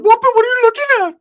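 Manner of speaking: high, loud squeaky tough-guy yell; tough guy challenge. Retold or imitated. Teller mocking Ricky mouthing off at the locals, loud high squeaky challenge. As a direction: squawk it out like a wannabe hard kid. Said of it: "He's talked like that all the time".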